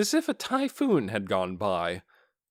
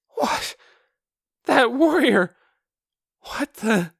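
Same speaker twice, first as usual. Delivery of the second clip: much higher, loud startled outburst; shocked guard; 13 semitones higher, 7 dB louder, slower than usual. Acted. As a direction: gasp out in disbelief. Guards gasp in disbelief.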